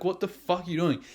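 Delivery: usual speaking voice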